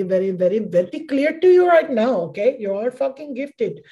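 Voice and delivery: put-on playful Indian guru accent, rising emphatic; lightly put-on Indian accent. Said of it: "let me get that Indian accent off me".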